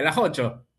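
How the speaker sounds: Son las ocho with the final s aspirated. Shown as a demonstration. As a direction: drop the s of las into a breath h, Santa Fe style. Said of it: "hace una, una J".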